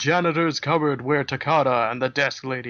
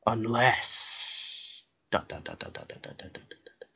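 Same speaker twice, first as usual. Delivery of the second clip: trailing dots; 4 dB quieter than usual. Acted. Reads ellipsis as fading dots.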